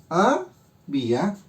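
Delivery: "Había" drawn out slowly, split by a pause into "Ha" and "bía"; syllable by syllable. Shown as a demonstration. Teacher marking syllables for students, "Había" slowly broken into "Ha... bía". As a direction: say "Había" in two separate slow pieces, "Ha... bía", the first rising. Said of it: "y marco mis sílabas".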